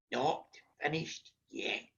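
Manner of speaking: very soft, low, slow reply; hushed stern granny. Retold or imitated. Granny overrules frightened children, slow hushed voice. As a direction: breathe it slowly, refusing to stop. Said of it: "My granny said".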